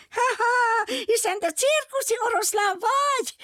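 very high squeaky little monkey voice, laughing; squealing monkey. Acted. Little monkey squeals at the circus lion, very high and laughing. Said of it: "visította a majmocska".

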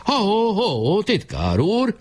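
high drawn-out halting call. A narrator's reading. Weiss calling after the running secretary, high and drawn out. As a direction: stop him with a high call. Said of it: "rákiáltott".

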